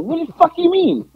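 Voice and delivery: high-pitched, disbelieving comeback; retold incredulous retort. Wrestler to the trainer scolding him, incredulous retort.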